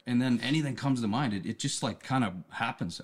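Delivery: normal talking voice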